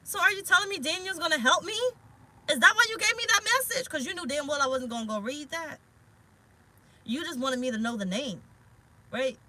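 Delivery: realizing. Retold or imitated. Reader retells asking Spirit about Daniel, excited realization. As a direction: it just clicked, ask it eagerly. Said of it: "And I was like".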